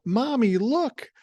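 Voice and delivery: high-pitched imitation of a kid blurting out; screaming little kid. Kid announcing to Mommy across the restaurant, high mock scream. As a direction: mimic a small child calling out, drawn out. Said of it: "The kid screams out".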